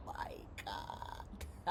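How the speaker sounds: barely voiced, hushed amazement; awed whisper. Real, not acted. Speaker amazed by own affirmation, awed whisper. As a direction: whisper it in disbelief.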